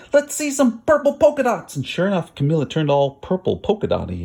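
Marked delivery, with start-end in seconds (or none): 0.1-1.8 put-on kid's call. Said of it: "One yelled out".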